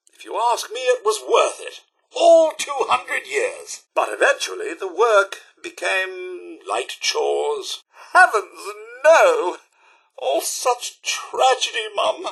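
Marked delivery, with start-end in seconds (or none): 2.2-3.8 high, drawn-out stress on two hundred years; emphatic exclamation. Acted. Robot butler tells employer of long toil, emphatic exclamation. 8.1-9.6 loud horrified outcry; shocked exclamation. Acted. Robot butler hears tragic news from employer, shocked outcry.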